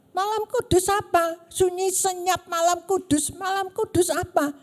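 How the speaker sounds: mock the friend's angry message, raising the voice; angry outburst. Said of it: "tiba-tiba dia marah-marah".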